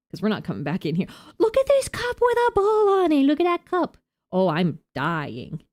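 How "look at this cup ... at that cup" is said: high, squeaky amused voice; tickled by a cup on a bowl; squeaky delight. Real, not acted. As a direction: coo over the cup in a tiny voice.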